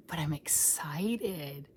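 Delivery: slow, whispered glee; excited whisper. Real, not acted. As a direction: let it slip out as a secret. Confiding excitement at end.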